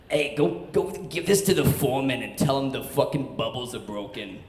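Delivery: imitated New York accent stuck in the throat; lightly put-on, throaty New York accent. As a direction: give the order as a New York boss. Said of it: "he talked like he had a New York accent stuck in his throat".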